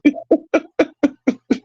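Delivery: real high-pitched cackle; helpless staccato giggles; high giggling laugh. Real, not acted. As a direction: crack up in high, quick bursts. Cracks up at his story.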